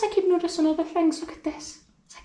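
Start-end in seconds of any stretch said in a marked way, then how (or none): 1.2-2.3 awed, much softer whisper; hushed, amazed whisper. Real, not acted. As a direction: breathe it out in wonder. Hushed awe in the room.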